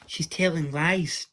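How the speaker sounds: angry shout. A narrator's reading. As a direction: call her out, indignant. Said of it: "Tom shouted angrily".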